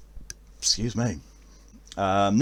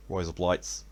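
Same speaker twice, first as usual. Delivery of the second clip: softer Aussie "Razor blades", sounding like "rise-uh blites"; Australian accent attempt; 4 semitones lower, 8 dB quieter than usual. Shown as a demonstration. Testing the Aussie trick, "Razor blades" as "rise up lights". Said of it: "the lights part isn't the right thing for blades".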